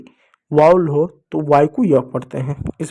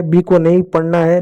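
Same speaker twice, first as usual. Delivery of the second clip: ordinary talk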